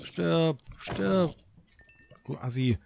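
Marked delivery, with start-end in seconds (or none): 0.0-1.4 yelling. Real, not acted. Fighting a monster.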